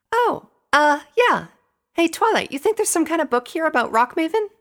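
very high, loud pony voice; high-pitched, eager character voice; Pinky, distracted then eager. A narrator's reading. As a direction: play a peppy pony pitched right up. Narrator voices Pinky asking Twilight.

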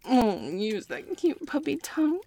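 ordinary talk

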